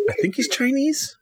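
high unsure aside. Real, not acted.

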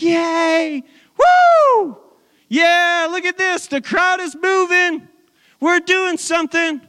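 high, elated whooping and chatter; excited cheering. Retold or imitated. Apostles imagined celebrating a moving crowd, excited cheering. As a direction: cheer like someone thrilled with the crowd's response. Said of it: "the people are getting all excited".

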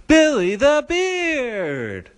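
high, drawn-out rallying shout of a name; announcer's call. Pretend. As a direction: stretch it out like a hype announcer. Host leads a chanted guest introduction.

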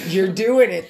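usual speaking voice

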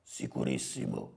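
very low, soft creaky growl; rough, hushed boss pronouncement; hornet boss. Acted. Voices Calabrone answering.

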